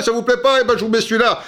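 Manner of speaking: a light imitation of the examiner; obliging examiner. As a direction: toss off the offer like it's nothing. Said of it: "le mec, il dit, bah".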